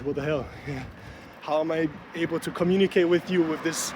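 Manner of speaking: ordinary talk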